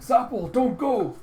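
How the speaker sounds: high, loud, drawn-out cry; desperate plea. Retold or imitated. Morris cradles his dying friend, desperate plea. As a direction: beg loudly for him to stay.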